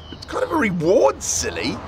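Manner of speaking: put-on high, teasing young girl; girl's voice. A narrator's reading. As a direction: tease him gently, a young girl. Robin teasing Eddie.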